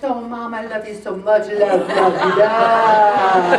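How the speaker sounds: gushing, drawn-out loves; mock gushing son. Son texting his mom, gushing and hammed-up. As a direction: overdo the sweetness, half-laughing. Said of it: "I get this text".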